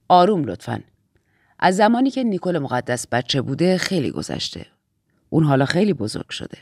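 low-pitched, measured authority; low, grave briefing voice; composed Aunt Lydia figure. Acted. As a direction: speak as the aunt in charge, pitched well down.

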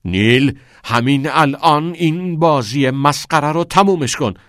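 angry shout. A narrator's reading. Father shouting at Neil, angry. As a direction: bark at him to stop. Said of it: "آقای پری فریاد کنان گفت".

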